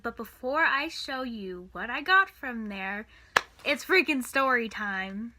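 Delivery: ordinary talk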